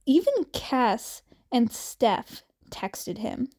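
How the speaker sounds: plain reading voice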